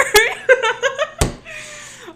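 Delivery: shrieking laugh; loud high cackle. Real, not acted. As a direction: burst out cackling. Laughs at own joke.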